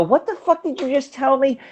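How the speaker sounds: high, stunned question; shocked disbelief. Retold or imitated. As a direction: blurt it out in disbelief. Retold shocked reply to friend.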